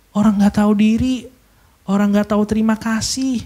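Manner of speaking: ordinary delivery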